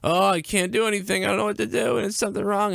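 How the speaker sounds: high, frantic imitation of mother; mock panic. Son mocking his panicking mother, high and frantic. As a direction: mimic her panicked wailing. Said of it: "she comes in here in a panic".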